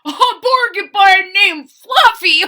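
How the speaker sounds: loud, high laughing shout; howling laughter. Acted. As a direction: howl it through laughter. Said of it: "howled the rhinoceros".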